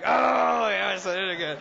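high, loud and proud; mock proud shout. Preacher telling his wife how proud he was, mock shout. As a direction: crow it like you nailed it. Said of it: "I'm like".

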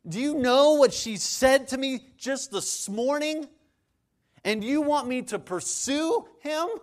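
high, slow, emphatic incredulous questions; indignant disbelief. Acted. Preacher plays an aggrieved spouse challenging the advice, indignant. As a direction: act outraged, dragging out each demand.

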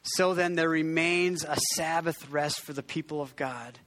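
measured, reverent scripture reading. Acted. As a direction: read the verse slowly and solemnly. Reads scripture.

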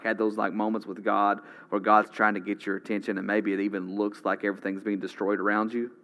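ordinary talk, nothing marked

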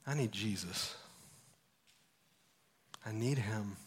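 low, quiet and heartfelt; hushed earnest confession. Acted. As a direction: let the plea sink to a quiet admission. Confessing need, voice drops away.

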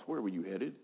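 normal talking voice

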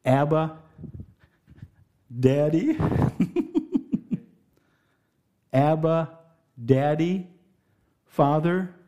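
a touch of tender affection; tender child voice. Shown as a demonstration. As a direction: call him like a trusting child. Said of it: "a voice that's gotta be changed".

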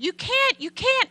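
high, loud retold scream. Daughter screaming at her father to stop, high and loud. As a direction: scream it at him in panic. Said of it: "she started screaming at him".

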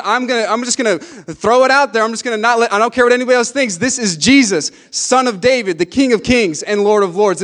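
high, forceful preaching declaration; defiant cry. Retold or imitated. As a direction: cry it out for all to hear. Voices blind man's defiant cry.

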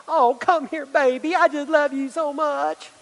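put-on high-pitched, cooing baby talk; doting parent. Parent melts at child's crocodile tears, mock doting coo. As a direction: gush over a little one, drawing out the end.

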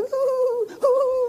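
very high squealed "ooh"; giddy giggle. Retold or imitated. Preacher imitates himself getting giddy in the truck, high giddy squeal. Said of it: "giggle, like".

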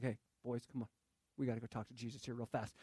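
quick, low, hushed mom voice; softly muttered, hurried summons; mom hustling her boys. Acted. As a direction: usher the boys along quickly, under your breath.

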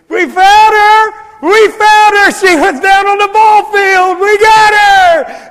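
very high, very loud triumphant shout; joyful shouting. Retold or imitated. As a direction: yell it out like you're running up holding the lost child. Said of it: "they're just shouting".

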